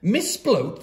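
plain reading voice

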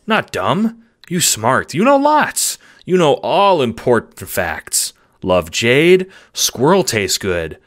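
self-satisfied pet, pitch swooping on key words; proud simple dog. Acted. Dog insisting it is smart, bouncy and proud. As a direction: boast like a simple-minded dog.

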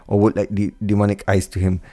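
ordinary delivery, nothing marked

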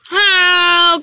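loud, high alarmed shout; crash shout. A narrator's reading. Trevor crying out as he crashes, loud shout. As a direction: yell as you tip over. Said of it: "cried Trevor".

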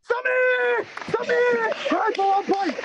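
high, ringing shout of the answer; excited shout. Real, not acted. Quiz host celebrating a correct answer, excited shout. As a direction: shout the name out, delighted.

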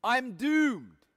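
mock despair, lightly acted by the preacher; anguished cry. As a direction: mourn "doomed" as if the end has come. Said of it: "I cried out".

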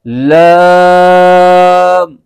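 लाम drawn out slowly with no sound through the nose; clear, no nasal. Shown as a demonstration. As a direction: say लाम slow and clean, no nasal hum. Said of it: "बल्कि". (लाम धीरे और साफ़, नाक में आवाज़ ले जाए बिना)

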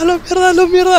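high, yelled cursing; panicked curse. Real, not acted. Player cursing mid-fight, panicked yell. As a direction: yell it twice in alarm.

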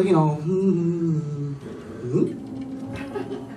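slow, drawn-out wondering hum; mock curious hum. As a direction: hum as if puzzled. Mock woman noticing corpse.

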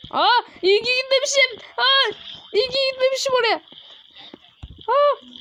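high, excited gasps of relief; relieved surprise. Real, not acted. Gamer glad he escaped the collapse, high excited relief.

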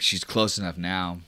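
normal talking voice

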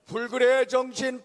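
usual speaking voice

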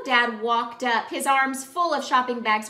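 plain reading voice

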